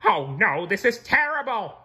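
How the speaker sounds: high loud dismay; cried. A narrator's reading. Squidward holding his head, loud cry. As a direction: cry out in alarm. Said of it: "cried Squidward".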